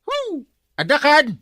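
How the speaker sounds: loud high shout to someone far off; loud high-pitched call with a whoop. Acted. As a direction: holler his name across the yard. Calling out for Kwarog.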